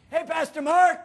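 put-on high, loud kid's holler; calling out. Little bus kid hailing his pastor mid-fight, bright loud call. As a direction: sing out a greeting from a bus window.

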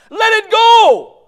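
slow, high, very loud cry; drawn-out shout. Real, not acted. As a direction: stretch it out and blast it. Preacher shouting.

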